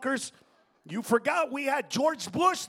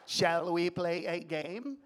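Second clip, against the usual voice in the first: robotic voice impression; 8 semitones lower, 6 dB quieter, much slower than usual. Impersonating Stephen Hawking voice.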